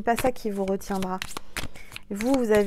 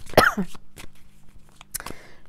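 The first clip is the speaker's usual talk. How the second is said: choking cough. Real, not acted. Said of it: "J'ai avalé de travers".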